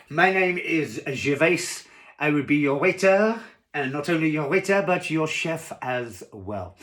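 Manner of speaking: put-on loud, slow French-accented introduction; French waiter accent. Waiter introduces himself to diner, in loud French accent. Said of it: "'cause he was French".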